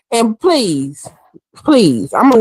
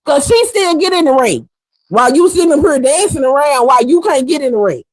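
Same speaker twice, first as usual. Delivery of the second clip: ordinary delivery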